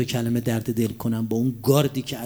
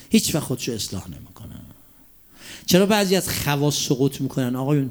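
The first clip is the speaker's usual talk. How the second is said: usual speaking voice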